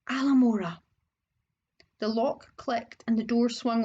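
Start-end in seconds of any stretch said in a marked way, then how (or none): none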